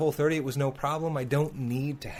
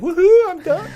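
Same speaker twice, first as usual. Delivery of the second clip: high, loud whoop of relief; excited cheer; 15 dB louder than usual. Retold or imitated. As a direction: cheer it like you just finished a challenge. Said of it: "was like".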